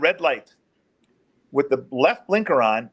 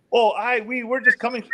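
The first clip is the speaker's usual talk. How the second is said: higher-pitched imitation of a suspect; changed, higher voice; 7 semitones higher than usual. Suspect explaining himself to officer, imitated higher voice. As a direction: imitate him with the voice going up. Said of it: "he changed his voice".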